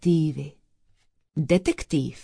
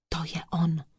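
softer, voiceless whisper; 6 dB quieter, much faster than usual. Acted. Detective spots the suspect in the inn, whispering. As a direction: whisper it as a private realization.

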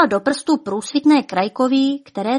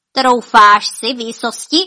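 laughing through teeth; 2 semitones higher, 8 dB louder than usual. Acted. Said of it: "Smála se skrz zuby".

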